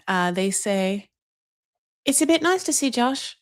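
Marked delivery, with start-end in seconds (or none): 2.1-3.4 put-on higher-pitched English accent, dry understatement. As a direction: imitate a politely understated English parent in an English accent. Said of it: "obviously, A, love accents".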